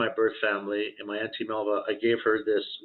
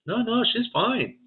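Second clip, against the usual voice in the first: high reassurance; 12 semitones higher, slower than usual. Retold or imitated. Sister reassuring about mom.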